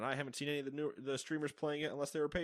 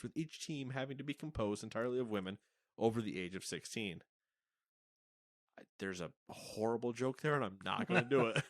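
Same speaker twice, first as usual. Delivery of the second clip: ordinary delivery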